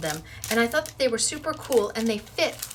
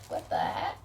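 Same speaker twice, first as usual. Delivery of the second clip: low whispery brain voice; 5 dB quieter than usual. Retold or imitated. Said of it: "my brain says".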